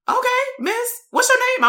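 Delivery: very high-pitched mock kid voice; imitated kid. Quotes the kid addressing the coach.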